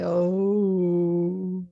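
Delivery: long OH easing slightly downward; falling pitch. Shown as a demonstration. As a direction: let a drawn-out OH sink gently. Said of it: "it's going down".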